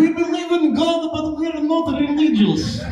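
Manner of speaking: high mock Russian church voice; hammed-up Russian accent. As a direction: imitate the audience member's heavy accent. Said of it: "You sound like a Russian church".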